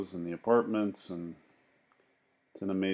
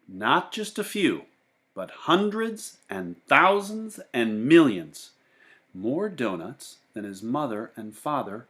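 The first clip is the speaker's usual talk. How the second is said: lively read-aloud voice for a class; brighter, higher storytelling voice; storybook narration; 5 semitones higher, 5 dB louder than usual. A narrator's reading. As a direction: read it to the kids, with bounce and wonder. Teacher reads picture book aloud.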